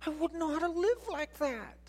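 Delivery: lightly voiced, high doubtful objection; a hint of a doubting listener. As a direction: say it like an unsure churchgoer. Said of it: "You say".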